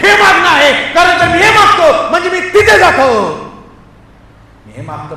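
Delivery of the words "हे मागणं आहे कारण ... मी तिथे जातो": building from raised voice to high shout; mounting preacher's cry; fervent prayer. Acted. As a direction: plead harder with every phrase. Prayer builds to full force.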